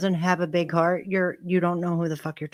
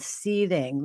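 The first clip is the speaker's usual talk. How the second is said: ordinary delivery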